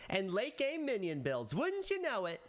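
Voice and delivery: spring the surprise with a high tease; playful surprise reveal. Shown as a demonstration. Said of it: "I think that was good".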